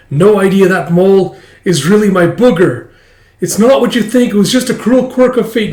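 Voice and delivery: put-on high, loud, overwrought boy; panicking schoolboy. Blond kid confesses his booger mistake, panicking. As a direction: wail the confession in mock despair.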